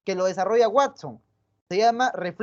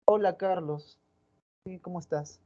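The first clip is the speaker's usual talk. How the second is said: a much softer, indifferent hello; cold greeting; 3 semitones lower, 6 dB quieter than usual. Shown as a demonstration. Teacher demonstrating how another girl greets Carlos coldly. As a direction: greet him like he bores you. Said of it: "por parte de otra".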